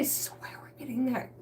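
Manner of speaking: breathy aside in a whisper; whispering a confession about earth science. Real, not acted. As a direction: whisper it like a secret. Trails into whisper.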